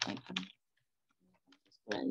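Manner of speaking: usual speaking voice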